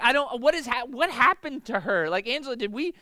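high, loud, baffled rambling; a dad thrown by his daughter's drama; bewildered parent. Retold or imitated. Father asking his wife Angela what went wrong, baffled. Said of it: "I'm like".

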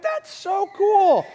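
high, loud, drawn-out gushing; hammed-up wonder, stretched slowly; mock awe. People seeing a new phone come out, mock awe. Acting out first reaction to phone.

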